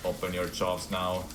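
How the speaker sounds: ordinary talk, nothing marked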